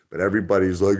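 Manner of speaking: usual speaking voice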